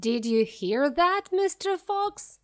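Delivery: high, loud taunt down a hole; jeering yell. A narrator's reading. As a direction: shout down the hole at the fox. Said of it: "yelled Bean".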